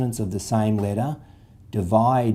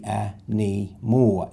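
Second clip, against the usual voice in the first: "a knee more" said slowly, syllable by syllable; split into syllables; much slower than usual. Shown as a demonstration. Teacher splitting the word slowly for the class. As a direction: break "a knee more" into slow separate parts. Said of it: "The Y will borrow the N for knee".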